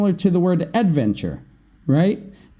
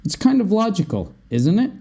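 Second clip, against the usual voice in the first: "It's kind of logical" pitched up, then "isn't it" rising; engaging question tag; 5 semitones higher, faster than usual. Shown as a demonstration. Teacher engaging the listener for an answer, "isn't it" rising at the end. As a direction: invite an answer with an upturned "isn't it". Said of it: "Trying to engage your answer".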